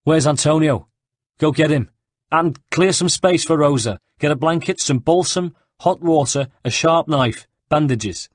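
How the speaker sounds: loud, high urgent commands; barking orders. A narrator's reading. Ugo calls out for supplies, loud and urgent. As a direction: Ugo shouts orders to the crowd. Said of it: "Ugo was already issuing orders".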